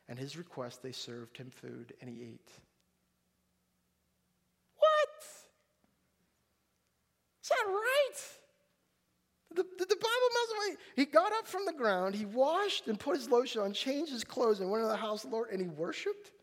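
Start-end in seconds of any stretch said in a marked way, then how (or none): 4.8-16.3 high, loud disbelieving squawk; incredulous. Acted. Preacher reacting to the verse with stunned disbelief. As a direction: shoot up in shocked disbelief. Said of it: "shocked reaction to the verse".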